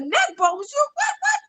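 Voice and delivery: high, climbing incredulous squeal; astonished disbelief. Retold or imitated. Wife can't believe husband asks for neck bones, astonished disbelief. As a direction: reenact being flabbergasted, voice jumping up. Retelling shock at the food request.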